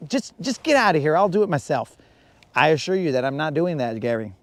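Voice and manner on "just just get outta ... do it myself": imitating wife. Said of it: "she's just like".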